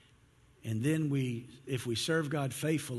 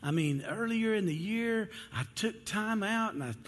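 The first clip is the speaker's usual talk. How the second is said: high-pitched listing of credentials; self-justifying plea; 8 semitones higher than usual. Acted. Believer bargains with God over good deeds, high self-justifying plea. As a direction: make your case to God, voice pitched well up.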